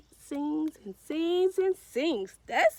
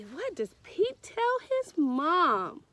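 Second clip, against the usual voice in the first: ordinary delivery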